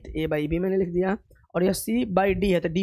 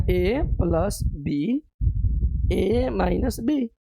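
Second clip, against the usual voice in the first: very slow, each term spaced out; slow dictation; much slower than usual. Shown as a demonstration. Teacher dictating the formula to students, very slowly. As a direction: lay out A plus B, A minus B word by word.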